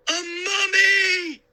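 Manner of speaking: high, loud terrified shriek. Acted. Boy shrieks as the mummy reaches for uncle. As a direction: shriek it at the top of your lungs. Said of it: "I shrieked".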